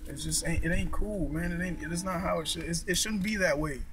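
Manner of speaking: ordinary delivery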